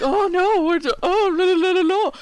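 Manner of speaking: very high mocking voice; high sing-song mockery; mocking falsetto. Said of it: "and you're like".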